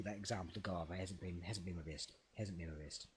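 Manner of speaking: quiet, downcast admission under your breath; low self-deprecation. Real, not acted. Guest owning up to a bad day, quiet and deflated. Apologising for own poor performance.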